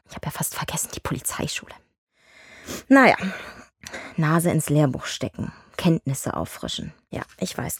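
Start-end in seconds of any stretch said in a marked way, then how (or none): 0.1-1.7 sarcastic whisper under the breath; whispered aside. Acted. 7.1-7.8 breathy sarcastic whisper. Acted. Muttered reply in argument.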